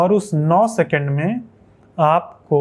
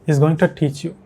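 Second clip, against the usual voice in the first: native English accent, linked words, TO as "tuh"; imitated native accent; much slower than usual. Shown as a demonstration. Teacher showing how natives say it, native English accent. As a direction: mimic native English accent in connected speech. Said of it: "ये कुछ इस तरह से बोलते हैं".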